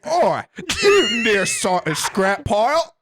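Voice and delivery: loud, high Yorkshire caricature; hammed-up Yorkshire accent. Host showing co-hosts a British insult, broad Yorkshire accent. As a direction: belt it out in an over-the-top Yorkshire accent. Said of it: "And you're from Yorkshire".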